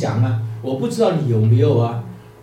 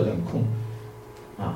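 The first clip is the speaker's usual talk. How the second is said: usual speaking voice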